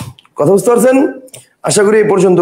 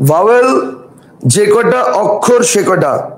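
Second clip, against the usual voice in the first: slow rule; slower than usual. Shown as a demonstration. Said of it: "teacher states rule for students".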